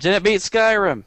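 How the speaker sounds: high, slow, put-on silly voice; high slow mock voice. Repeats in put-on voice.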